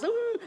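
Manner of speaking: high, soft mock whimper; imitated crying. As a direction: whimper as if crying your eyes out. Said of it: "I was crying my eyes out".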